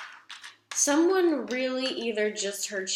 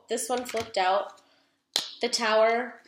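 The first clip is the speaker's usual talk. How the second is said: ordinary talk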